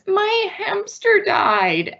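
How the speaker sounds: put-on high, slow, tearful little kid; high childlike voice, slow and grieving; sad child voice. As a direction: sound like a small child who just lost a pet. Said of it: "So the child comes in and says".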